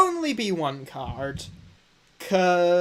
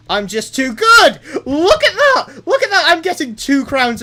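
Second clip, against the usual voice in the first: real gloating shout, louder and higher; excited shouting; 10 semitones higher, 10 dB louder than usual. Real, not acted. Player boasts to chat about being just too good, excited shouting. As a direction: burst into loud, high-pitched bragging. Gloating over a great run.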